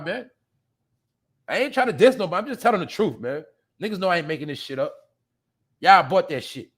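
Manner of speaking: ordinary delivery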